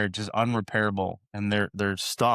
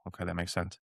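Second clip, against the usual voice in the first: much softer, meek okay; sheepish agreement; 3 semitones lower, 9 dB quieter than usual. Retold or imitated. Husband retells giving in to his wife, much softer. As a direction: concede it under your breath.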